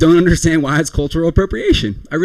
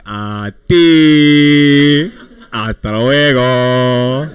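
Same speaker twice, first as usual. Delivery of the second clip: long held "A ti", then stretched "Hasta luego"; Spanish farewell tone; 3 semitones lower, much slower than usual. Retold or imitated. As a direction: drag out "A ti" and "Hasta luego" in a singsong. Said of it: "like, tones of voice".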